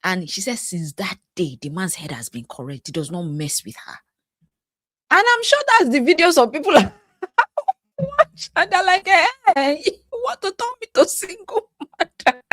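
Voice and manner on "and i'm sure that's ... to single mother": high, helpless laughter while talking; laughing. Real, not acted. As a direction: squeeze the words out through real laughter. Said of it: "So I'm laughing because".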